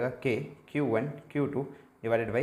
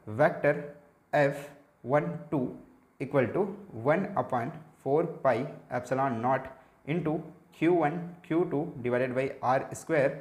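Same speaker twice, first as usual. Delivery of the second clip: slow dictation; much slower than usual. Shown as a demonstration. Dictating the formula to students.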